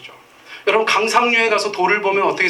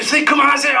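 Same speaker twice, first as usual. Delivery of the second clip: put-on kids pleading; 9 semitones higher, 4 dB louder, faster than usual. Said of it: "애들이".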